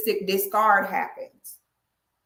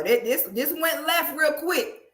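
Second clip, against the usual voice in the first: normal talking voice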